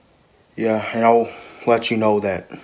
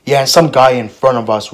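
normal talking voice

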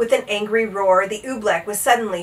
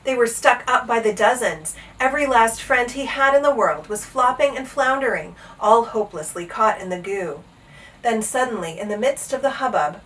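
usual speaking voice

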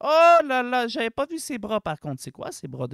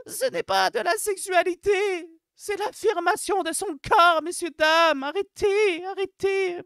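high-pitched, mock-solemn plea; hammed-up righteous outcry; impassioned protest; 6 semitones higher than usual. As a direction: protest in a raised, pleading pitch. Mock protest defending body pride.

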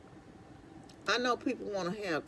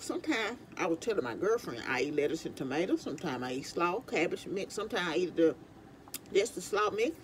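talking while chewing carrot; 4 dB quieter than usual. Real, not acted. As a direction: mouth partly full. Seen 4: Bites carrot stick between phrases; carrot at mouth while talking.

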